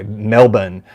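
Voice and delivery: butchered Australian "Melbourne", R dropped; Australian accent. Shown as a demonstration. American host apologetically trying a viewer's accent, Australian-style Melbourne without the R. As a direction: attempt Australian "Melbourne" as MEL-bun, second syllable reduced. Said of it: "with an Australian accent, it's more like".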